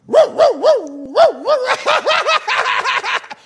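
high, loud, cackling fake laugh; imitating the convention's holy laughter, loud and high; mock holy laughter. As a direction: burst into an over-the-top mocking laugh. Mimicking the holy laughter scene.

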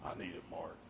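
a man near tears admitting need; tearful. Retold or imitated. Friend answering the preacher, quiet and tearful. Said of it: "he had a tears in his eyes".

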